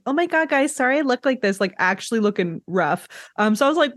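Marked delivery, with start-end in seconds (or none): 0.1-1.6 high gushing apology to camera; mock makeup vlogger. Vlogger apologizing to viewers for looking rough, lightly mocking. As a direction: lightly play a flustered vlogger. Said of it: "not like".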